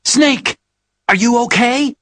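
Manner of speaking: high, loud urgent cry; alarmed call. Acted. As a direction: shout his name in alarm. Calling out to Snake over radio.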